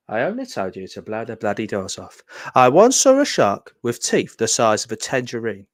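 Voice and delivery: a loose, playful stab at the star's famous lines; Michael Caine impression. Quoting Michael Caine's lines for the viewers, a light impression. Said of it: "I'm not very good at line reading".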